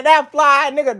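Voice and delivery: high, loud admiring street voice; street guy impressed. Retold or imitated. Street guy passing by, high and loud with admiration. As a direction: hype it up like a passerby in awe. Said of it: "He gonna be like".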